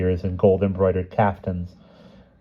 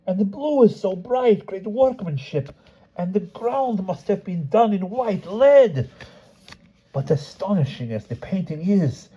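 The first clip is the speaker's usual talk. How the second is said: blacksmith in wonder; 12 semitones higher than usual. Acted. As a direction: savour every colour like a treasure. Blacksmith admiring painting and handle.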